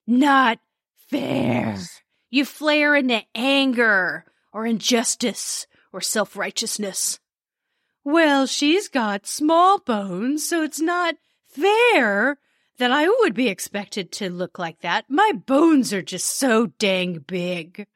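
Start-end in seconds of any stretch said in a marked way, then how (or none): 0.1-1.8 mock indignant. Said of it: "Compare and flare is like". 8.0-17.9 hammed-up whiny complaint; petulant, self-pitying grumble; whiny indignant. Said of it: "Oh, I love dramatizing these".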